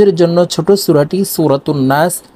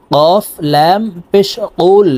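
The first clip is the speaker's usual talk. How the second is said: ordinary delivery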